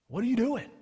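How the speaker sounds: usual speaking voice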